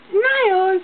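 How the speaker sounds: high, loud squeal of a cue. Real, not acted. Parent squealing at the baby to smile, high and loud. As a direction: burst out bright and high to get a smile.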